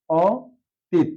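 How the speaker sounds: অতীত drawn out very slowly; slow model pronunciation. Shown as a demonstration. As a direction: stretch অতীত syllable by syllable. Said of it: "আমরা জানি দীর্ঘ ইকার".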